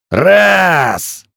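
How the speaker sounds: high, loud barked count; shouted count. A narrator's reading. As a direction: shout the number. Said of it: "выкрикнул дикарь".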